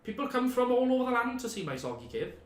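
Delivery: lightly voiced chatty hermit; a hint of a cheery cave dweller.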